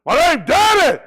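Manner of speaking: high, loud proud declaration; imitating a proud shout. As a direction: bellow it like a preacher. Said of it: "Started talking like he was".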